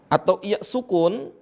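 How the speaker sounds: normal talking voice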